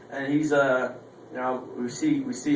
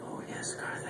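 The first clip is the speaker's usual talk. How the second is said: breathy whispered invitation, very soft; seductive whisper; 16 dB quieter than usual. Pretend. Sister silently inviting Karthik, in a soft seductive whisper. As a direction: whisper it like a come-on.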